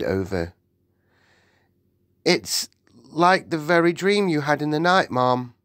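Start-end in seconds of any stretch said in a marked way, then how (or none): 2.3-5.5 higher, hesitant servant voice; nervous maid. Acted. The maid tells the Queen her dream is printed, nervous. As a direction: break the news timidly.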